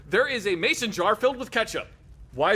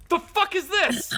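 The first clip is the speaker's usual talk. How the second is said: slow, raised-pitch disbelief from the captain; captain's baffled demand; 11 semitones higher, slower than usual. Retold or imitated. Soldier retells his captain demanding an answer, baffled. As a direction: drag out the question like a stunned officer. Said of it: "Captain just looks at it and goes".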